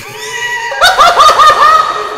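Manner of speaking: very high, loud cackle; shrieking laughter. Real, not acted. Fan overcome by the best song, shrieking laughter. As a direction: burst into a long squealing laugh. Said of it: "I'm laughing because this is the best".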